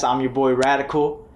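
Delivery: ordinary delivery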